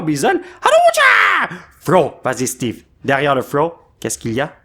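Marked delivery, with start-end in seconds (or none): 0.6-1.6 sudden high excited yelp; excited shriek. Real, not acted. Excited yelp at the pulled card. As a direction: squeal at the card reveal.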